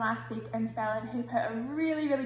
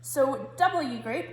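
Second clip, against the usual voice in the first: calling the group; 4 semitones higher, 4 dB louder, much slower than usual. Real, not acted. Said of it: "calling on group".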